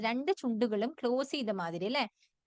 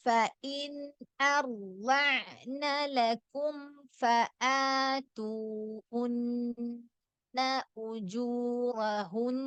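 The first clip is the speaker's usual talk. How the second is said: slow melodic tajweed recitation; drawn-out Quranic chant; much slower than usual. Shown as a demonstration. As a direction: chant the verse slowly for students to repeat. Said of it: "Again, ഒന്നുകൂടെ".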